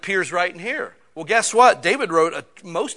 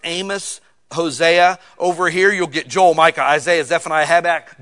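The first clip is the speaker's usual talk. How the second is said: ordinary delivery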